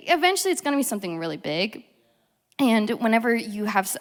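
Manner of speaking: ordinary talk, nothing marked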